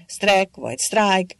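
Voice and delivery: "sztrek" said short with e, then "sztrájk" with long á; two pronunciations. A narrator's reading. Zrínyi unsure how to say the foreign word, trying "sztrek" then "sztrájk". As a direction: slowly weigh "sztrek" against "sztrájk". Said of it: "akárhogyan is kell ejteni".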